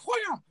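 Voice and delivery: high-pitched spoken punch sound effect. Shown as a demonstration. Friend acting out the Bruce Lee one-inch punch, sound effect. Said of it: "the sound effects though".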